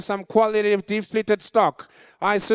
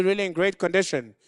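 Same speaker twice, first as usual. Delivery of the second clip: usual speaking voice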